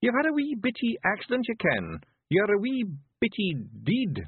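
high, rough little Scottish pictie, gently; a tiny Scots warrior breaking bad news; Feegle voice.

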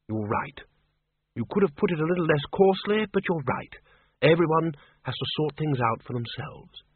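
lightly voiced, mild reproach; Windle Poons, conceding. Windle accepts the librarian's blunt advice, mildly reproachful. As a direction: concede the point with a touch of reproof.

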